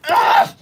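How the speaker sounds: high-pitched exasperated groan; frustrated shriek. Real, not acted. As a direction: squeal of sudden annoyance. Cat ears appear mid thanks.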